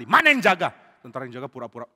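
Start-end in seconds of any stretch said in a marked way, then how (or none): 0.0-0.7 loud, higher outburst; mock-furious raised voice; angry demand. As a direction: demand who was on guard. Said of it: "Abner marah sekali".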